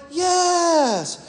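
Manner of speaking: high mock kids' cheer; kids shouting yes. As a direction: chirp it up high like eager kids. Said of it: "And they all shouted".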